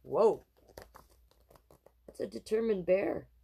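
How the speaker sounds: plain reading voice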